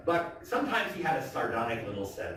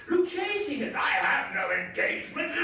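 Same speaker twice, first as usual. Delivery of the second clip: much higher, tipsy laughing shout; laughing Fortunato. Acted. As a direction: wave it away with a drunken laugh. Fortunato brushes it off.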